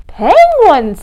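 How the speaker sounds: high, loud surprised question. A narrator's reading. Tacky answers the penguin hunters, surprised. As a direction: exclaim it as a startled question.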